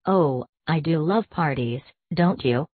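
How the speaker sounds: usual speaking voice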